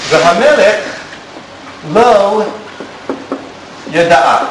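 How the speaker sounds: word by word with long pauses; והמלך, לא, ידעה, each set apart like dictation; slow dictation. Shown as a demonstration. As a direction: spell it out slowly for the board. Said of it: "I'm gonna write that here".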